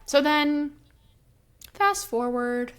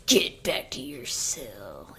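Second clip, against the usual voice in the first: lower, slow commanding order; mock stern command; 7 semitones lower, slower than usual. Rhys ordering Feyre in front of Amarantha, lower and stern. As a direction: play the cold master sending her off, low and slow. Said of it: "and Rhys is like".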